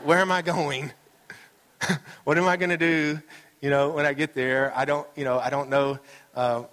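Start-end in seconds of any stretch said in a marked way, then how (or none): 0.1-4.7 higher, louder mock indignation; playful re-enactment of a fuming driver; retold road rage. Driver retelling his chase to congregation, mock indignation.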